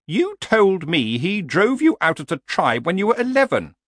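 high horse voice, arguing; objecting horse. Acted. Horse questions killing a troll, high and argumentative. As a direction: challenge him, pitched well up.

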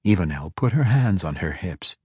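ordinary delivery, nothing marked

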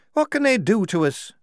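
high, anxious question; worried prisoner's voice; nervous. A narrator's reading. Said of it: "said Shufti nervously".

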